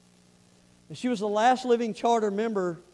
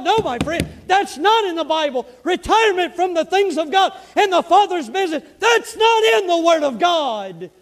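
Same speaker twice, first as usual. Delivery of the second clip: shouted preaching; 7 semitones higher, 10 dB louder than usual. Real, not acted. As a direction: thunder it from the pulpit.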